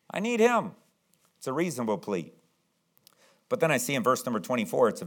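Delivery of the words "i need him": pleading. Acted. Voices Jairus' need for Jesus.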